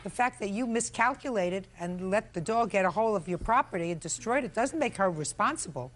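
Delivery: normal talking voice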